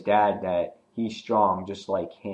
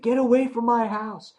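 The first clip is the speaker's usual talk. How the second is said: much higher, louder warning; protective shout; 12 semitones higher, 5 dB louder than usual. Retold or imitated. Glenn defending his home, much higher shout. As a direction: order them off your property. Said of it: "And he's like".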